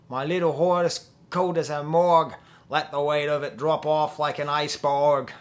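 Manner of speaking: loud, hammed-up Australian accent. Shown as a demonstration. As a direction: belt it out in a bad Australian accent. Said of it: "Maybe if I say it with an Australian accent".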